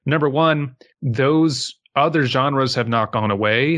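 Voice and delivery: ordinary talk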